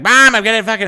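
loud, high imitation of an exasperated son; yelling back at mom. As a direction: shout back at your mother in protest.

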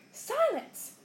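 high-pitched yell. A narrator's reading. Uncle silencing the boy, yelled. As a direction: cut them off with a yelled order. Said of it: "yelled Uncle Vernon".

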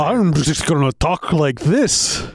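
slow, high, hammed-up Scottish accent; bad Scottish accent. Co-host mocks the fake-accented Imperial, hammy Scottish. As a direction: exaggerate a fake Scottish brogue, drawn out. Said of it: "I can't do a Scottish accent".

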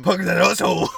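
loud, hammed-up lemonade seller; commanding puberty voice. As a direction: belt it out like a bossy cracked-voice teen. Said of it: "my voice was so commanding".

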